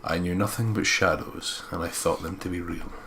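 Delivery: ordinary talk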